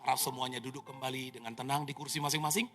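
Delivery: calm the passengers like crew on the intercom; flight attendant announcement. Retold or imitated. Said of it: "Pramugari mulai ngomong".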